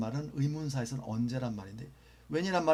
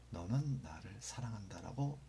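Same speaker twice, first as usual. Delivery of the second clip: whisper; 4 semitones lower, 7 dB quieter than usual. Shown as a demonstration. Teacher translating example sentence.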